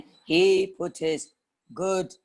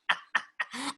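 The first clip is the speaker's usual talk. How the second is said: witch cackle; 8 semitones higher, 6 dB quieter than usual. Acted. Witch laughs.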